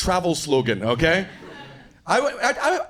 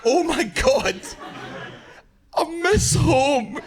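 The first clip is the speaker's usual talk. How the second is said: high, very slow laughing shout; high laughing wail of homesickness; homesick cry; 8 semitones higher, much slower than usual. Real, not acted. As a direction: drag it out, half laughing, half yelling. Overwhelmed reaction to the story.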